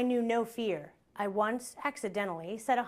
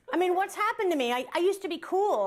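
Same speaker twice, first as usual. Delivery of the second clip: high-pitched, exasperated outburst; exasperated lament; 7 semitones higher than usual. Acted. Comic bemoaning lost cool to the audience, exasperated. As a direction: wail it in comic dismay at yourself.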